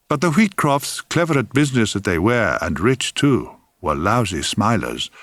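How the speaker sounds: ordinary delivery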